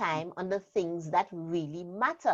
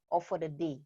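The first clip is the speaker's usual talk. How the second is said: ordinary delivery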